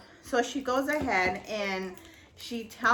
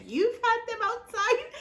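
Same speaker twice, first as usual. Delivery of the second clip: much higher, laughing question of disbelief; incredulous echo; 12 semitones higher than usual. Retold or imitated. Echoing her sister's claim back, laughing disbelief. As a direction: repeat it back in stunned disbelief.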